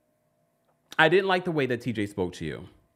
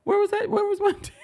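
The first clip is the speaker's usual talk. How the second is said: high squeaky where-was-she; sudden high-pitched puzzled squeal; surprised squeal; 3 dB louder, faster than usual. Real, not acted. High squeal searching for someone.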